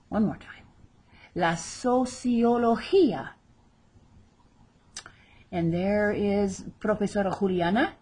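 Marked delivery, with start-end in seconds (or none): 1.3-3.3 slow "sociología", stressing the "gí"; stress on final I. Shown as a demonstration. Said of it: "accent over the final I where we stress the word".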